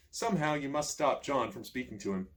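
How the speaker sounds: usual speaking voice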